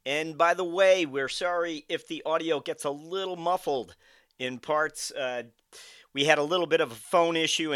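loud, upbeat show host announcement; announcer read. Acted. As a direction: read the break like a radio host.

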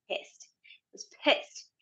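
whispered, slow and seething; whispered anger. Real, not acted. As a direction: hiss the word pissed under your breath. Admits anger, drops to whisper.

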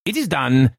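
high, triumphant announcement; high-pitched jubilant proclamation; exultant cry. A narrator's reading. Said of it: "I entered crying".

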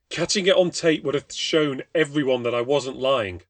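a terrified man begging to be believed; desperate pleading. Acted. Desperate Craig pleads his case.

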